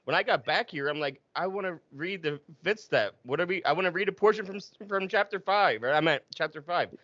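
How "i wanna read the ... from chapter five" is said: upset. Retold or imitated. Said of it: "and it, and it upset".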